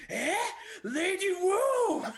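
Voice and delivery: high, loud, drawn-out shout; big high-pitched cry of discovery; astonished delight. Retold or imitated. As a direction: imitate a man exclaiming in amazed recognition. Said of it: "And then the guy goes".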